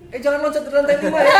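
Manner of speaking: high, loud mock scolding; scolding mother. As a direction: yell at the kids like a fussy mum.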